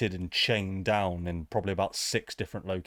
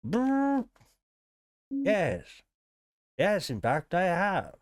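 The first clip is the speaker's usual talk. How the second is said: robot NPC voice; 9 semitones higher, slower than usual. Acted.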